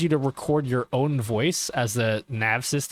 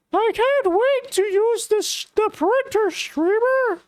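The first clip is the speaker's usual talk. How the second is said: put-on high, nerdy teen voice reading cringe chat; Morty voice; 3 dB louder, slower than usual. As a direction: squeak it out like an awkward Morty. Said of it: "read it on accident in Morty's voice".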